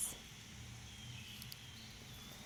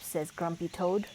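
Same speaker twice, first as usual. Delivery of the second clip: ordinary delivery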